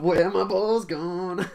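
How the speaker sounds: slow, high, loud whine; hammed-up plaintive cry; mock whine. Mock lament continued.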